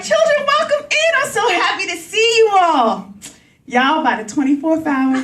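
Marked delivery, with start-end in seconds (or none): 0.0-3.1 gushing welcome. Retold or imitated. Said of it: "I was like".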